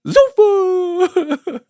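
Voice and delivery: very high, loud mock Italian cry; Italian shout. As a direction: belt it out like an opera singer. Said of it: "get this fake Italian sounding".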